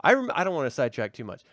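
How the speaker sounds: ordinary talk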